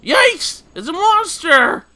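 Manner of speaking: high, loud shriek of fright; panicked scream. Acted. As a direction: yelp in terror like you've seen a monster. NPC panics at seeing monster.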